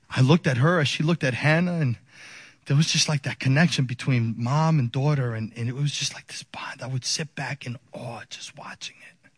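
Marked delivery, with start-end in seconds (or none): 5.3-9.3 very soft, near-whispered wonder; hushed awe. Real, not acted. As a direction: let it sink to a reverent hush. Recalling awe at mother and baby.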